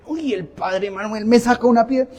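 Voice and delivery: mock exasperation. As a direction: act mildly fed up, as a put-on. Acts out being angered by the priest.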